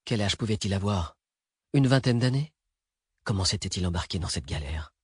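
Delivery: usual speaking voice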